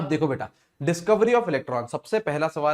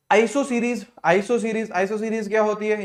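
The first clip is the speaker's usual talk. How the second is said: eager announcement; 6 semitones higher, 3 dB louder, slower than usual. Acted. Announcing a new topic.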